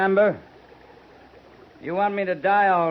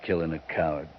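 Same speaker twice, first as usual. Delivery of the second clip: much softer, much lower, contemptuous; quiet disgust over a beaten man; cold and low; 10 dB quieter than usual. Acted. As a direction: Quince sparing Patchen, quiet and contemptuous. Quince stands over beaten Patchen.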